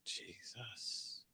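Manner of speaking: a breathless whispered Jesus; whispered exasperation. Real, not acted. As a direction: whisper it in disbelief. Despair over the Knicks.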